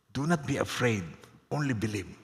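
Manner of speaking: a gentle hushed reassurance; softly reassuring. Shown as a demonstration. Preacher quoting Jesus to the congregation, soft and gentle. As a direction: do not be afraid said softly and low. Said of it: "Sabihin niyo po yan".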